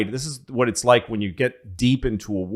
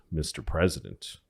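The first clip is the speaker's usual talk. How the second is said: much softer, icy politeness; Eleanor's cool reply; 7 semitones lower, 11 dB quieter than usual. Retold or imitated. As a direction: hand the question back quietly. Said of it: "And she says".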